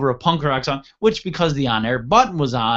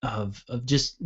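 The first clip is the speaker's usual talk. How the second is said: ordinary talk, nothing marked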